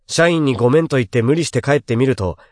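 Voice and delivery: ordinary delivery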